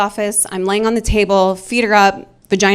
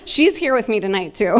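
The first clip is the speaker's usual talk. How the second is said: ordinary talk, nothing marked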